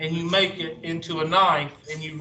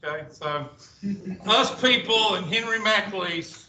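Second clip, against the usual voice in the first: normal talking voice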